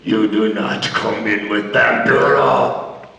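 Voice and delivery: put-on deep, slow accented wrestler; low gruff command in a foreign accent; wrestler's order. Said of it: "He goes".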